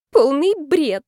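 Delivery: high, loud exclamation; dismissive outburst. A narrator's reading. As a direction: wave it off indignantly. Heroine dismisses friend's summary.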